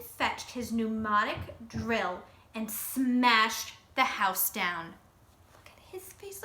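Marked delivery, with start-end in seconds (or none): 5.5-6.4 high, hushed whisper; whispered aside. Real, not acted. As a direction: point it out in a whisper. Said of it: "narrator aside".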